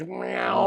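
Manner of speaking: a quick vocal clipper buzz; clipper buzz imitation. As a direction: meow mimicking hair clippers. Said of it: "That sound when you take the clippers".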